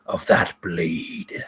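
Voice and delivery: low, drawn-out skeletal king voice; Frozen King, contemptuous. Acted. Frozen King bringing his maul down on Bentley, low and scornful. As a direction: pass slow, deep judgment as an undead king. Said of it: "as he says".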